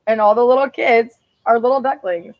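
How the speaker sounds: usual speaking voice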